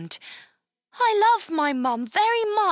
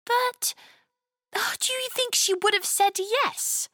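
put-on higher, hopeful young girl's question; 5 semitones higher than usual. A narrator's reading. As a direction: ask it like a child hoping for a yes. Chloe hopes mum would agree.

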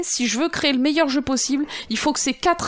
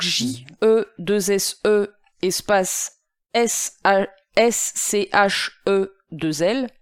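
slowly spelling out letters; 3 semitones lower, slower than usual. Shown as a demonstration. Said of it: "le livre, c'est The Art of Game Design de Jesse Schell".

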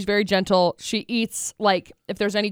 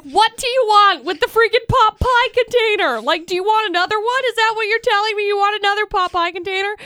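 baffled and fed up with the dog; exasperated; 8 semitones higher than usual. Retold or imitated. Asking the dog about the pot pie container, exasperated. Scolding dog in retold story.

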